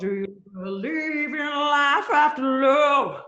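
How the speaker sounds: slow, sung [name] imitation; [name] impression. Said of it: "a cog trying to sound like [name]".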